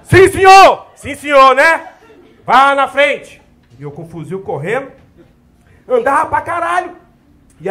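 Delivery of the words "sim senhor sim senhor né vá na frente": loud army shouting; shouted yes sir. Retold or imitated. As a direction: yell it like drill on the march. Recruit and sergeant shouting.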